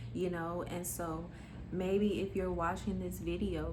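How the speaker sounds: normal talking voice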